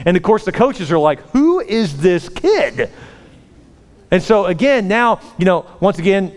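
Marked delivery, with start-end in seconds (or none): 1.3-2.9 slow, high, drawn-out amazement; astonished. Retold or imitated. Coaches react to Jack's touchdown, astonished. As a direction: stretch every word in disbelief.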